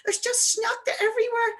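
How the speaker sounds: put-on high-pitched child voice; high, excited mock child; insistent little boy. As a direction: imitate a wee boy repeating himself to Mummy. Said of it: "He's going".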